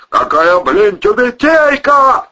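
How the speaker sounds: loud, drawn-out yell climbing in pitch. A narrator's reading. Man demanding an explanation about the skullcap, yelling. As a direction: bellow the question, stretching it out. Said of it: "заорал Протасов".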